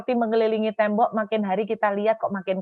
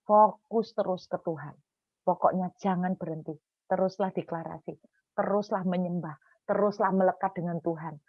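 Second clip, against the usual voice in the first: soft, measured, lowered intensity; solemn pastoral exhortation; 2 semitones lower, 5 dB quieter than usual. Real, not acted. Preacher gives mothers tonight's message, soft solemn exhortation. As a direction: urge them gently, phrase by phrase.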